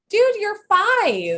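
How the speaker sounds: high, slow, loud disbelief; incredulous. Pretend. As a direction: stretch it out in disbelief. Incredulous reply to son's news.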